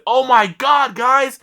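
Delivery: high, loud mock astonishment; telling onlookers the loser quit in shock; amazed exclamation. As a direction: gush in disbelief to the crowd. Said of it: "And I said".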